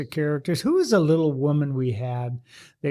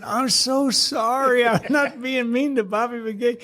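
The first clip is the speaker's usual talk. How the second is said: sheepish apology; 8 semitones higher than usual. Retold or imitated. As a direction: sound small and sorry, begging to be believed. Apologizing to the fire chief's wife.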